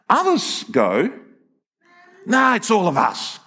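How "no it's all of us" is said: raised-pitch scoffing comeback; mock dismissive objection; dismissive retort. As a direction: scoff back as the opposing camp. Voicing the opposing camp's retort.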